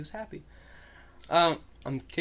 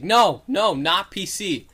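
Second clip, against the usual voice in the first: loud, higher mock protest; exasperated quoted objection; freaking out, retold; 3 semitones higher, 7 dB louder, slower than usual. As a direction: imitate someone losing patience and correcting. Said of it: "he's freaking out at him".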